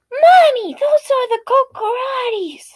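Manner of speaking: put-on high childish whine, drawn out; whiny kid voice. Narrator voices the entitled kid complaining to mom, high childish whine.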